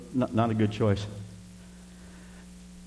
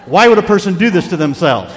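louder and higher, laughing at the end; loud exasperated rant; 6 semitones higher, 10 dB louder than usual. Retold or imitated. Freezing hunter shouting his complaint from the tree stand. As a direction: throw it out, fed up. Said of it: "I'm standing twenty-two feet up there shivering".

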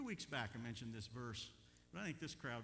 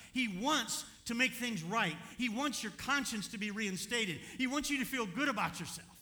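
full-force preaching; 8 semitones higher, 10 dB louder than usual. Real, not acted. Preacher assuring church what God wants, full-force preaching.

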